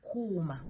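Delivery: Kuma with a drawn-out u; Kuma, lengthened first syllable; long vowel. Shown as a demonstration. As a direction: hold the u in Kuma. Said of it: "to have some long vowels".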